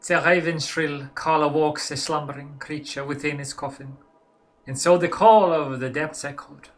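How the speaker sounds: loud, dramatic poem in mock German accent; hammed-up German-accented recital, slowing at the end. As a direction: declaim it like a German gothic poet, German accent. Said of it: "now in German".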